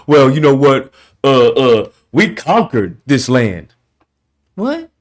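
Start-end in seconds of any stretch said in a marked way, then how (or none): 0.1-3.7 mock boastful man. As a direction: puff up like a bragging guy. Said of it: "mocking repeated boasts".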